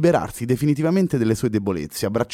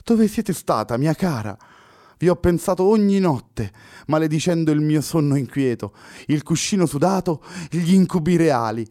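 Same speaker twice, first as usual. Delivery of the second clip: high, louder voice shaking with disbelief; Pessoa trembling voice; 7 semitones higher, 4 dB louder than usual. A narrator's reading. Poet confessing sleepless nights to his lost love, trembling. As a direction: tremble as if seeing a ghost. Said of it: "con la voce tremante".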